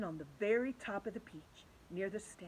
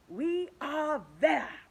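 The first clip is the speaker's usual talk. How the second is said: forced cheer; 8 semitones higher, 8 dB louder, much slower than usual. Acted. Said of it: "trying to be cheerful".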